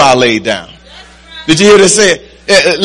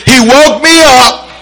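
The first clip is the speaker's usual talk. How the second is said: spaced phrases rising to a higher, emphatic peak; rousing preaching; 4 semitones higher than usual. Real, not acted. Preacher gives the reason for testimony, rousing and building.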